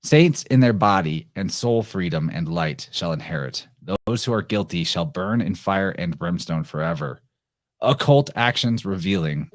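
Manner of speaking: measured, solemn recitation of verse; dramatic reading of a prophetic poem. Acted. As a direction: read it like an ancient prophecy. Reads the Sibyl's poem aloud.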